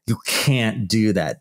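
usual speaking voice

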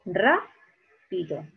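"rápido" slowly split as RA... pido; stress on first syllable. Shown as a demonstration. Teacher confirming where "rápido" is strongest, first-syllable stress. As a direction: hold RA in "rápido", pause, then finish. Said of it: "aquí está la mayor fuerza de voz".

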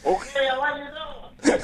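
high, put-on grumble; Bill Cosby. Comic as Bill answers being told off, high-pitched. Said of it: "Bill, I am trying".

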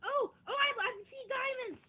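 high, loud thrilled outburst; excited. Real, not acted. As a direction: burst out with delight. Finding diamonds in game.

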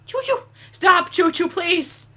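high, rising calls to the train; pleading call. Real, not acted. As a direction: coax the train like a pet. Begging the train to stop.

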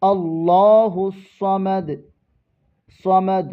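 slow, melodic recitation of the verse; Quran recitation. Shown as a demonstration. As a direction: draw out each word in tajweed. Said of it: "ওকফ করার কারণে সমাদ পড়তে পারবো". (ধীর সুরেলা তিলাওয়াত)

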